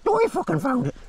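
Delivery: high silly bragging dog voice; voicing the dog. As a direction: boast like the dog who won the fight. Dog voice in mock argument.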